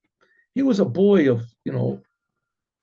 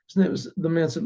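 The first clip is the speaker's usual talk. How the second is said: ordinary talk, nothing marked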